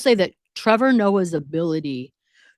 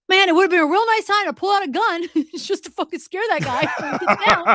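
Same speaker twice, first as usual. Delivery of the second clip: laughing mock thought; 11 semitones higher, 4 dB louder than usual. Acted wish to pull gun.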